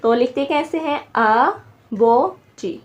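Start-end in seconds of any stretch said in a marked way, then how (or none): 1.2-2.8 आबो जी slowly as आ, बो, जी; syllable by syllable. Shown as a demonstration. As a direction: dictate आबो जी in three separate syllables. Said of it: "तीन syllable बन रहे हैं".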